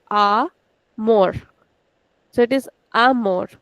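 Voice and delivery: ordinary talk, nothing marked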